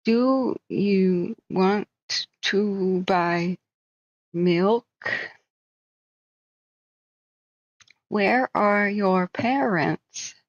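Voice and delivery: put-on slow, patronizing words to a small child; mock condescending adult, very slow; talking down to a child. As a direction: spell it out slowly as if to a toddler. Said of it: "talk to me like".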